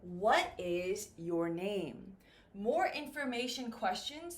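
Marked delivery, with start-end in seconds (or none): 0.0-2.2 slow, low question, "name" dropping at the end; falling question intonation. Shown as a demonstration. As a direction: spell out each word and let "name" fall. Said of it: "the pronunciation will go down".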